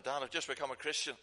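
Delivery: a mild retold call to someone downstairs. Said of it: "I called down to my dad".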